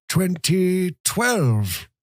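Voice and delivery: put-on shrill it out slowly like a frail old man. A narrator's reading. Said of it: "he shrilled".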